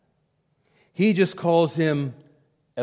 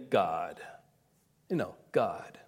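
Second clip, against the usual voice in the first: dismissive God; 9 semitones lower, 9 dB quieter than usual. Retold or imitated. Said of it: "just by saying".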